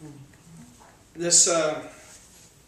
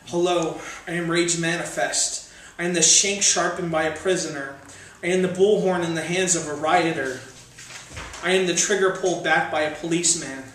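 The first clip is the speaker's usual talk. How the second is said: louder menacing poem voice with a falling hello; ominous spoken-word, a touch raised; 3 semitones higher, 6 dB louder than usual. Acted. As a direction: announce yourself as rage itself.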